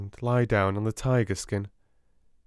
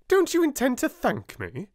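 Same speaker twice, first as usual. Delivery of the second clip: much higher, louder indignant demand; indignant headmistress; 4 dB louder than usual. A narrator's reading. Miss Minchin demanding thanks.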